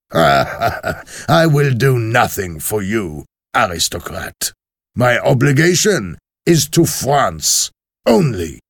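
slow, unbending jailer voice; lightly voiced hard revolutionary refusing; cold refusal. A narrator's reading. Defarge refuses to help the aristocrat, slow and cold.

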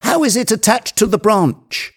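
very high, eager question; excited exclamation. A narrator's reading. Legrand pressing Jupiter for details, high and excited. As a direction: ask urgently at a keyed-up pitch. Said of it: "exclaimed Legrand".

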